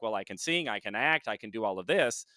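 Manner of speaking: normal talking voice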